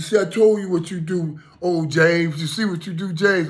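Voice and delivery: high, loud, animated buddy impression; crowing imitation of a revived friend; recovered friend's voice. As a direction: call it out high, full of triumph. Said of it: "he was sounding like this".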